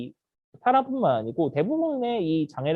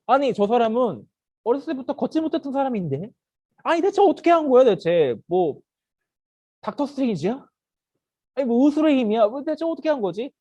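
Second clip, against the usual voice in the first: high, raised incredulous questioning; baffled disbelief; 6 semitones higher than usual. Acted. Crowd wondering how the lame man walked, baffled disbelief. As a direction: question it as if nothing makes sense.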